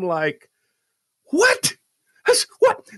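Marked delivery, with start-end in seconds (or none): 1.3-2.9 high, loud outraged yelp; retold shriek of outrage; freaking out. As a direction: react in high-pitched disbelief. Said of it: "like, freaking out".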